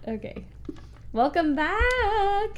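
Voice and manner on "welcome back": slow, high mock radio host; imitating his DJ welcome; radio voice imitation. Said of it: "you never do that one then".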